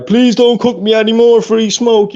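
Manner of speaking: high, drawn-out mocking whine; mock crying plea. Taunting rival about crying last day, mock crying plea. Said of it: "Crying there".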